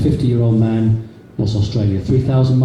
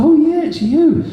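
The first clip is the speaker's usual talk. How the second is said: much higher, slower, lightly put-on woman's voice; cheerful shop assistant; slower than usual. Cashier to customer, hammed-up high chirpy voice. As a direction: chirp it like a bright cashier spotting him.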